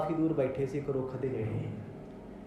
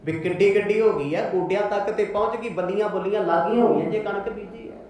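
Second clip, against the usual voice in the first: ordinary talk, nothing marked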